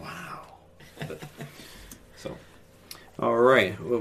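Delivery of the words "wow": a soft, breathy whispered wow. Retold or imitated. Co-host whispering his amazement to the hosts. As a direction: let the wow out under your breath. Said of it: "It's like".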